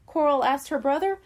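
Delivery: ordinary delivery, nothing marked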